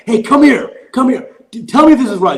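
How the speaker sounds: high, loud call to strangers; accosting passers-by. Retold or imitated. Girlfriend accosts people walking by, calling out loudly. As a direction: flag down a stranger, imitating her. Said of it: "accosting people walking by".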